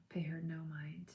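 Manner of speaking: unvoiced, confiding whisper; whispered aside. Real, not acted. Whispering advice to Jessica about Marlo. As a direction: lean in and whisper it.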